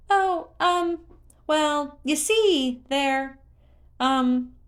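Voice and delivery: very high, halting ums; stall, caught out; flustered creature. A narrator's reading. Hestu dodging Link's question, stammering in a very high voice.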